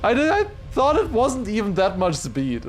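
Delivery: high, loud exasperated complaint; frustrated outburst. Real, not acted. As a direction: vent loudly at the game. Frustrated after a failed jump.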